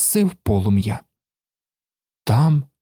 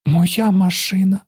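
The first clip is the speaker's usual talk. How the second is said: sobbing; 7 semitones higher than usual. A narrator's reading. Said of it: "схлипнув він".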